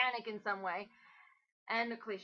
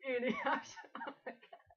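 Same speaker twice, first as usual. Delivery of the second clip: normal talking voice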